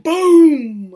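big drawn-out booming burst; explosive boom. Retold or imitated. Boat blows up mid-recap, explosive boom.

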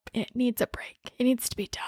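sudden hushed whisper. Real, not acted. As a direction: drop to a whisper. Opinion, dropped to whisper.

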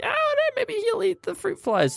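high breezy voice. Retold or imitated. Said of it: "I'm like".

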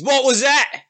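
high-pitched ogre voice; challenging ogre. Acted. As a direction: demand it as an affronted ogre.

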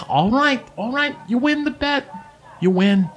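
high-pitched voice conceding reluctantly; Sly, grudging. Acted. Sly paying the gnome's bet, grudging. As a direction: give in with high-voiced exasperation.

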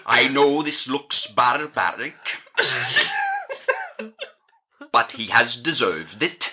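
put-on Welsh warden, long pauses; lilting, over-articulated Welsh; Welsh accent. Warden justifies a beating to prisoners, Welsh accent. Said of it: "a Welsh accent".

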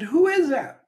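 high, loud call across the valley; little boy calling out, high and loud. Retold or imitated. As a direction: shout it across to the far side.

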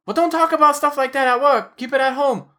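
put-on high, scolding Filipino-accented wife; high-pitched mock Filipino accent. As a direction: imitate a wife laying down rules in a Filipino accent. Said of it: "a Filipino accent because his wife is".

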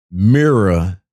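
usual speaking voice

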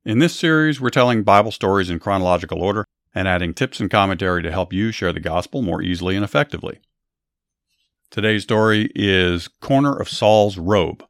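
bright, announcer-style welcome; upbeat show host intro. Acted. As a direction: greet the listeners like a radio host. Host welcome and episode setup.

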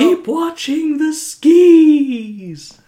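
high, very loud, drawn-out SKIS; hammed-up quote shout. Quoting The Simpsons as sign-off, loud drawn-out mock warning. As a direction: bellow it slowly, stretching "skis" with an ee.

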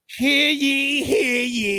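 high, proclaiming town crier call. Pretend.

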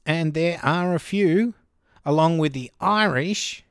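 normal talking voice

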